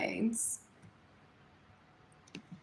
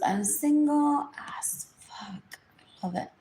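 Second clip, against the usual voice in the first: whispered boast; 5 semitones higher than usual. Real, not acted.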